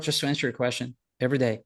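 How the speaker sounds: ordinary talk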